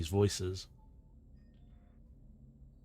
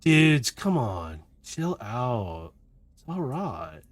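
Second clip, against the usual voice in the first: weird other voice; 2 semitones higher, slower than usual. Acted. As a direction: play a chilled-out dude, stretching the words. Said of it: "you suddenly hear this other voice".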